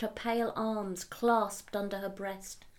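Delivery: usual speaking voice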